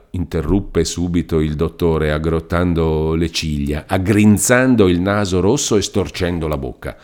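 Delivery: plain reading voice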